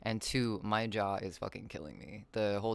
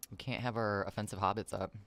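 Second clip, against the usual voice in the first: ordinary delivery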